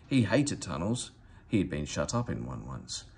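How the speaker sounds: ordinary delivery, nothing marked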